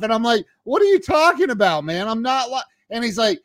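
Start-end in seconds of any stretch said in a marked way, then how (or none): 0.6-2.6 high, exasperated retold protest; pissed off. Customer arguing back at psychiatrist, pissed-off mock outrage. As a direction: cut in indignantly, pitched way up. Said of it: "I got really pissed off".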